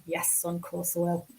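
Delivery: hushed, compliant mock whisper; barely voiced, dutiful agreement; meek whispered reply. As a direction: whisper it like an obedient parent humouring a son. Meek reply to son, retold.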